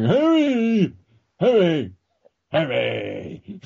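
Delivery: mock creature call, high then sinking; Pokemon calling its name. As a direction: cry the name like a pocket monster. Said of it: "popping up going".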